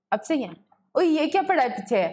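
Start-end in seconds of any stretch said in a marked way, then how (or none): none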